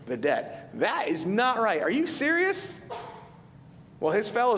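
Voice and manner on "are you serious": much higher, shocked disbelief; incredulous. Real, not acted. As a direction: gasp at the injustice, pitch jumping way up. Outraged preacher reacting to parable.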